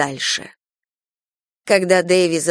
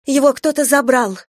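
high, urgent alarm; frightened exclamation in a raised voice; panicked cry; 8 semitones higher, 4 dB louder than usual. A narrator's reading. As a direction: blurt it out in panic, pitched right up. Mother panics over missing baby.

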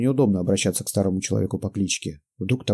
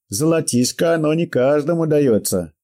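put-on old prospector; 7 semitones higher, 4 dB louder than usual. Old man voiced in story.

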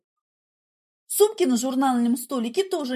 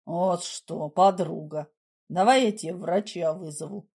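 calm; 7 semitones lower than usual. A narrator's reading. Igor offers to call a doctor, calm. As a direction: say it evenly, as if nothing happened. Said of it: "Игорь говорил спокойно, как будто ничего не случилось".

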